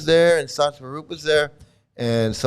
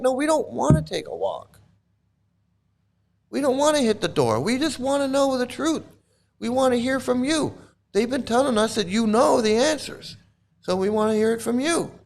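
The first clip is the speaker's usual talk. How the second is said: pleading devotees; 9 semitones higher than usual. Retold or imitated. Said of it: "And they're like".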